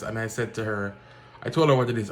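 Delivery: ordinary talk, nothing marked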